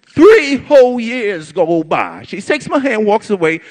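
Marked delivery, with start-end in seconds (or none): none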